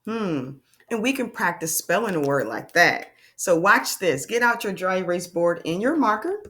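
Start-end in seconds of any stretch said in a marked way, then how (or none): none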